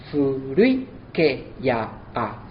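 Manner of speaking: furui ke ya a very slow, vowels joined, final a added; Spanish syllable count. Shown as a demonstration. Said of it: "Se haría una sinalefa".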